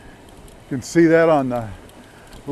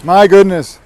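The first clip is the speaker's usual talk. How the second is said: shouting over wind; 2 semitones higher, 9 dB louder than usual. Real, not acted. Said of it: "this wind is so strong".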